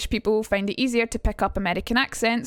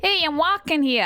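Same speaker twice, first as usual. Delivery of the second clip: higher-pitched rough stab at a Boston accent; attempted Boston street accent; Boston East Coast accent; 6 semitones higher than usual. Shown as a demonstration. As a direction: call out in an amateur East Coast American accent. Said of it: "I'm not good at the Boston East Coast accent".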